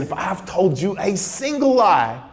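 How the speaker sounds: slow, high-pitched protest of honesty; imitating an emphatic denial; insistent oath. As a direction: swear it slowly, pitch climbing. Retold prisoner swearing he is honest.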